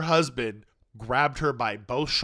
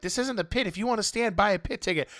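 higher, snooty mock of the scolding fans; 7 semitones higher than usual. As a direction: parrot their scolding higher and snippy. Neighbours scolding her for standing.

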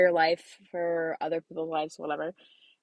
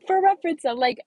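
higher, louder emphatic aside; excited; 8 semitones higher, 6 dB louder than usual. Real, not acted. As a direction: punch it with excitement. Repeat said with more emphasis.

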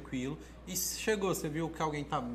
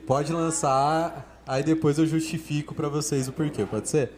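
calling out to viewers; 9 dB louder, slower than usual. Real, not acted. Calls out to viewers.

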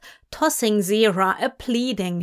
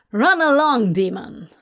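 hateful; 3 semitones higher, 5 dB louder than usual. A narrator's reading. Archangel dismissing the frozen demon, hateful. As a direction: send him off with contempt. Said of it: "Gabriel glared hatefully at him".

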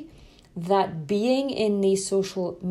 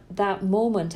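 ordinary talk

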